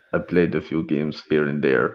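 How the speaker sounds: low, slow attempt at a Northern Irish accent, laughing; a failed stab at a Northern Irish accent; mock Northern Irish accent. Asked by his co-host, he tries a Northern Irish accent. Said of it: "try to do a Northern Irish accent".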